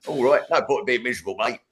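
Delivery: cockney bloke. Retold or imitated. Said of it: "he'd be like".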